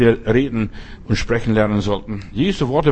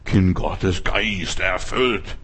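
mock pious claim, lower, softer and slow; smug self-assurance; 7 semitones lower, 4 dB quieter, much slower than usual. Preacher mimics a complacent believer's claim in a mock-smug voice. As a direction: imitate a smug believer, drawn out. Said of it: "Hast du die richtige Meinung".

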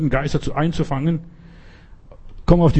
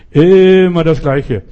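loud, slow, fed-up outburst; exasperated outburst; 4 semitones higher, 7 dB louder, slower than usual. Real, not acted. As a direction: drag it out in exasperation. Exasperated preacher. (laut und gedehnt genervt)

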